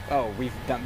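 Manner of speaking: normal talking voice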